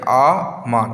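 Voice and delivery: "আ মার" as two separate beats; a clear gap between আ and মার in "আ মার"; teacher sounding out আ–মার; breath break between syllables. Shown as a demonstration. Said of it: "break করা আছে".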